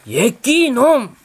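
put-on playful old-man rebuke; scolding exclamation. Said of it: "따라했어요".

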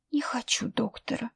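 weak, fearful whisper. A narrator's reading. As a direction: barely breathe it out. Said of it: "прошептала она". (больная мать шепчет сиделке)

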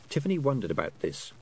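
plain reading voice

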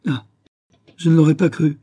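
mock him with a sneer. Lily jeers at Gus's claim, sneering. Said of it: "ricana Lily".

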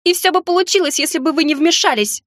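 high, strained yell; shouting. A narrator's reading. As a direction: keep shouting it back at him. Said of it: "закричала она".

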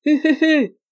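loud, high hooting cackle; Jean-Marie's sniggering laugh. A narrator's reading. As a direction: cackle "hu-hu-hu" like a delirious drunk. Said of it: "ricanait le roi de la mer".

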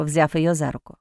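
usual speaking voice

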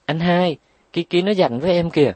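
high, fast, whining plea to big brother; whiny little sister. A narrator's reading. Little sister Trúc Nhã whines to her brother, high and fast. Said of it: "dậm chân phụng phịu".

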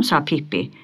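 ordinary delivery, nothing marked

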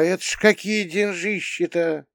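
high-pitched wondering exclamation; drawling amazement. A narrator's reading. Mother wondering at such big money, drawling amazement. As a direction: marvel in a high, stretched voice. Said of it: "протянула мама".

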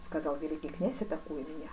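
usual speaking voice

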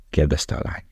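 ordinary delivery

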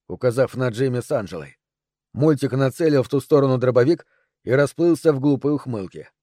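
usual speaking voice

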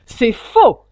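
very loud, abrupt denial; crying out. A narrator's reading. The hare hastily interrupts the hatter, shouting. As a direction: shout it to cut someone off. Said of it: "s'écria le lièvre".

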